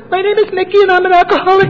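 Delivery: put-on high squeaky girl's voice; high mock woman's voice introducing herself; mimicking a girl. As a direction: squeak it out like the young woman at the meeting.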